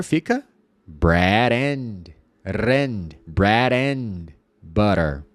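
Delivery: "bread and butter" with the D dropped onto the vowel, Portuguese tap R; "bread and butter" slowly, D sliding into "and"; linked R. Shown as a demonstration. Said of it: "ao invés de bread and butter". ("bread and butter" ligando o D na vogal)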